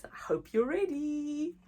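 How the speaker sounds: ordinary delivery, nothing marked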